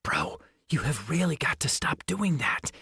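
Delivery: unvoiced whisper through clenched teeth; hissed whisper. A narrator's reading. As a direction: hiss it through your teeth. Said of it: "I hissed through clenched teeth".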